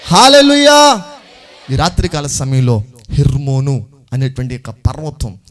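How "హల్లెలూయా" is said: high, sustained rallying shout; loud hallelujah shout. Real, not acted. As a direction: shout it out to rouse the congregation. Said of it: "కొంచెం గట్టిగా చెప్పండి". (గట్టిగా హల్లెలూయా నినాదం)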